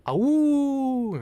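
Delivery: high, loud falsetto oohs; imitating Jim. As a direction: try a silly squeaky swoop of sound. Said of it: "How does Jim do it?".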